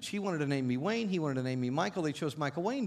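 ordinary talk, nothing marked

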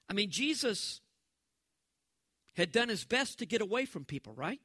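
ordinary talk

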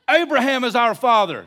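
high, loud retort; indignant outburst. Retold or imitated. Crowd answering Jesus about their father, indignant outburst. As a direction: blurt it out loudly in protest. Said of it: "And they popped out".

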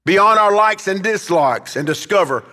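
high, slow, emphatic pulpit delivery; preaching exhortation. Real, not acted. Preacher calls church beyond its own feelings, forceful exhortation.

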